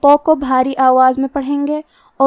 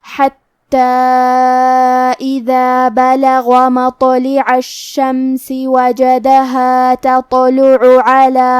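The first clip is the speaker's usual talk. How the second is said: slow, louder chanted tajweed recitation; Quran recitation; 3 dB louder, much slower than usual. Acted. Teacher reciting the full verse as a model, slow chant. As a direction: recite the verse unhurried, each rule sounded.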